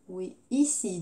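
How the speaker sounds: ordinary talk, nothing marked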